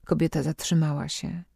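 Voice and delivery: usual speaking voice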